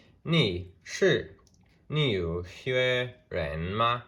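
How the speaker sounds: "你是纽约人吗" read very slowly with each Mandarin tone clear; slow Mandarin demo. Shown as a demonstration. Teacher reading the board example to students, slow. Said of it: "Okay, so this says".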